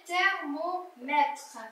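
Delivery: thermomètre said very slowly and clearly; teacher slowly modelling thermomètre; slow modelled reading. Shown as a demonstration. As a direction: stretch out thermomètre for the pupil. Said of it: "teacher models word slowly".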